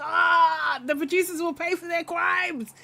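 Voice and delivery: high, hammed-up wail of vengeance; mock outrage. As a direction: cry out in theatrical indignation.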